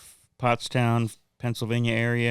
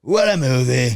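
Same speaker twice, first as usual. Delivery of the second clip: loud, higher put-on impression voice; running impression; 4 semitones higher, 7 dB louder than usual. Comic redoing it for the guy beside him, loud mock impression. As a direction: belt out the hammy bit. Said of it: "Mine's bad".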